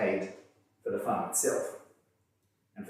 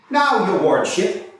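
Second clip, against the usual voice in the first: loud, higher, pointed courtroom pleading; cross-examine with cutting, forcible flair; quaint forcible prisoner; 7 semitones higher, 9 dB louder, slower than usual. Acted. Frank cross-examining witnesses before the judge, loud forcible pleading. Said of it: "mode of delivery were both quaint and forcible".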